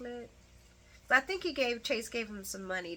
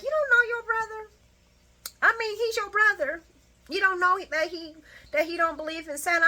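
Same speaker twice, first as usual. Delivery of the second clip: much higher, louder disbelief; incredulous side-eye; 10 semitones higher, 7 dB louder, faster than usual. Retold or imitated. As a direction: scold Brooklyn in high disbelief. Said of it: "I'm looking at Brooklyn like".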